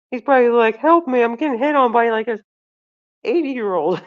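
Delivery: loud, high, amused mock plea; laughing retelling. Real, not acted. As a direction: tell it loudly on the edge of cracking up. Laughing, imagines doctor's plea.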